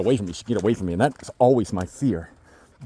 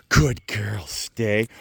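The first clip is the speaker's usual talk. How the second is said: ordinary delivery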